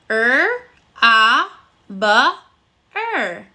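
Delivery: robber split into separate sounds with pauses; sounding out phonemes. Shown as a demonstration. Teacher counting the sounds of "robber" aloud. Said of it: "let's count the number of sounds".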